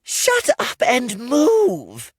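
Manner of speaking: high, loud and slow snap; high, loud, drawn-out snap; snapping. Acted. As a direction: farm girl brushing off the kneeling men. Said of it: "she snapped".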